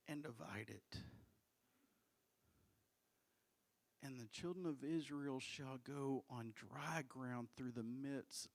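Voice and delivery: low, hushed, measured reading; solemn scripture reading. Acted. Reads scripture aloud.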